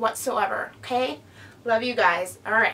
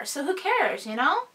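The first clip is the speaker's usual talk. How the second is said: ordinary talk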